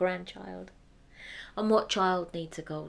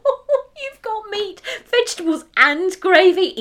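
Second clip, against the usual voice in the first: much higher and louder, gushing; delighted wife; 11 semitones higher, 14 dB louder than usual. Acted. The wife guesses the gift, high and gushing. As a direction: gush with growing greed.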